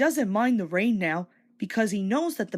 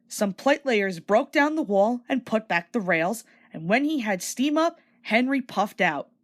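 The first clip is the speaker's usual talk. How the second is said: plain reading voice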